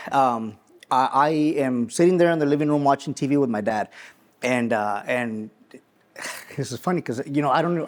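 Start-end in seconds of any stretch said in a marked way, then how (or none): 4.4-7.9 choking up. Real, not acted. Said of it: "I don't know why I'm choking up".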